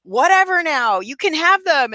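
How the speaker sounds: friendly offer. Retold or imitated. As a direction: hand over the cookies with forced casual cheer. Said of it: "I was just like".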